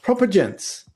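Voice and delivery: mock British accent. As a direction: put on a posh British voice. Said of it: "the British one's funny".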